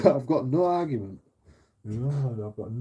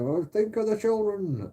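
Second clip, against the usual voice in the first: mock pearl clutcher; 8 semitones higher than usual. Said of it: "my kids might hear".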